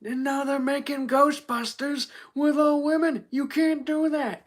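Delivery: high, slow, drawn-out complaining politician; Donald Trump. Acted. Streamer trying it on chat, high slow Trump rant. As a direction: complain in a stretched, high impression. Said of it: "I can't do a good Trump".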